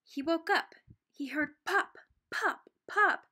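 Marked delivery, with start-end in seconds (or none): 2.3-3.3 popping sound. A narrator's reading. Imitating popping.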